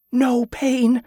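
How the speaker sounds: put-on high girl's voice, marvelling; high, measured amazement; awed excitement. A narrator's reading. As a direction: dwell on it in high, girlish wonder. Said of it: "excited at last to tell her tale".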